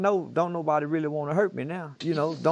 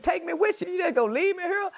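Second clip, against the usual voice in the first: panicked plea. Retold or imitated. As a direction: mock-desperate high-pitched pleading. Acts out his unspoken plea to police.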